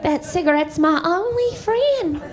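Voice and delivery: put-on high, slow kid voice; comic's child self. Child confiding about the cigarette, high slow kid voice. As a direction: play a forlorn little kid, drawn out. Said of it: "And I was like".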